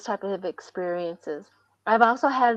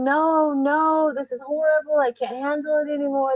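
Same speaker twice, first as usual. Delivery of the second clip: retold yelling; 5 semitones higher than usual. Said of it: "And I'm yelling".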